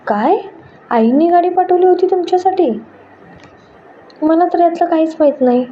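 high, startled disbelief; astonished. A narrator's reading. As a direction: blurt it out amazed, pitch jumping up. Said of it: "Dhanashree चकित होऊन म्हणाली".

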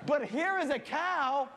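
high, loud, emphatic comic outburst; slow down and punch it with mock indignation; exasperated retort. Boy answering his buddy's silly question, loud exasperation.

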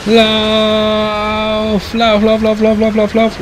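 panicked high yelling to run; frantic shrill urging to flee; being chased. Real, not acted.

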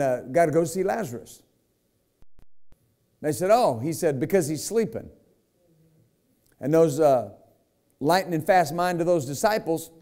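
rising, pressing sermon delivery with dramatic pauses; preaching. Acted. Preacher retelling Lazarus to the congregation, fervent preaching. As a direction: preach it to the church, building and dropping.

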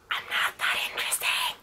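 hushed, voiceless aside; whispered disbelief. Retold or imitated. Vlogger reacting to newspapers wanting to talk, whispered disbelief. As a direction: whisper it as a modest confession. Retold bashful reply to press attention.

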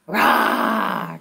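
mock tiger rah for kids; playful pretend roar; gentle hammed-up roar. Shown as a demonstration. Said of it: "What does a tiger say?".

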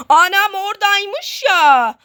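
high, slow, drawn-out alarm; startled cry. Real, not acted. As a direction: cry out on suddenly spotting the monster. Spots the monster in game.

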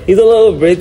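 put-on high, loud British accent through laughter. Joking with a friend about accent slips, mock British. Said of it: "my accent be changing to British".

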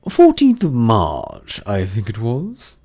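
loud, slow uncertain hatter voice; the Hatter guessing the date; Hatter recalling. A narrator's reading. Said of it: "he said".

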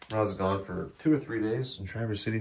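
ordinary delivery

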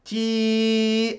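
long, high, buzzing "Tee"; high ringing vowel. Shown as a demonstration. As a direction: hold "Tee" on one high ringing note. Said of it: "do you hear that high ringing".

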